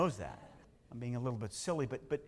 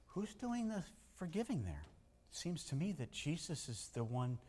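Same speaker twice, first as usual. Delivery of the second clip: ordinary delivery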